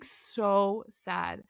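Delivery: usual speaking voice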